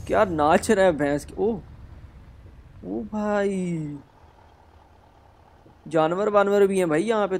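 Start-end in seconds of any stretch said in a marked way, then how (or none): 1.4-4.0 1 drawn-out awe. Real, not acted. Awed at animals on road.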